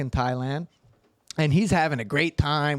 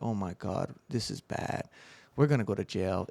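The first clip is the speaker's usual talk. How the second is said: quiet dread; 6 semitones lower, 7 dB quieter than usual. Retold or imitated. Retold dread at the scene.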